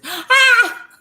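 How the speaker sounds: sharp high yelp from burning; pained yelp. Real, not acted. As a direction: yelp at the hot bite. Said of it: "So that's why I'm screaming".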